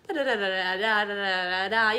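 mock sales babble. Mocking sales pitch babble.

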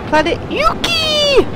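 high, drawn-out cry; excited announcement. Real, not acted. Player reaching a new planet, calls its name out. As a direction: stretch it out like a grand arrival.

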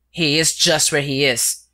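plain reading voice

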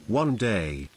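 plain reading voice

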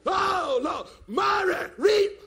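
loud, high wail, stretched out; mock hollering. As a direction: holler it out like someone in agony. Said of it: "He went to hollering".

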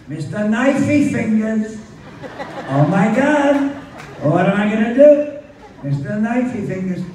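high, loud panicky mother. Retold or imitated.

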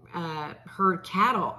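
ordinary delivery

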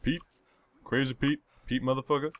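hammed-up boast; character intro. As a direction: announce himself in character.

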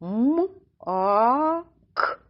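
hummed М, long А, short К; мак pulled slowly apart with a long high А; drawn-out word. Shown as a demonstration. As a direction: stretch мак sound by sound: М, а, к. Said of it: "Спочатку протягнемо це слово".

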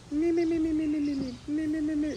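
high, thin repeated meeps like gibberish; alien hearing screams as noise. Retold or imitated. Explaining how screams sound to an alien, high squeaky meeping. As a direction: mimic meaningless alien-heard squeaks. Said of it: "all the alien hears is".

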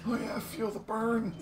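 high mock workout cheer; hammed-up gym bro. Hamming up a workout cry.

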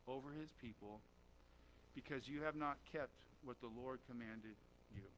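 low, hushed Bible reading; measured, subdued pulpit reading; scripture reading. Acted. Reads scripture aloud to congregation.

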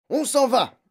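high, loud brusque announcement; gruff father shouting; curt shout. A narrator's reading. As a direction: snap it out loudly, too busy to explain. Father barking at daughter while packing.

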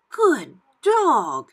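high, loud officer praising a dog; exclaiming praise. A narrator's reading. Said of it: "he exclaimed".

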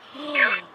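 a sudden high, loud gasp of fright; sharp gasp. Real, not acted. Vlogger startled by forest sounds, sharp gasp.

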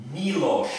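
Milos said as MEE-losh; correct pronunciation. Shown as a demonstration. Vlogger showing viewers a common name, correct MEE-losh. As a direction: say Milos with an ee and a final sh. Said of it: "It's not Milos".

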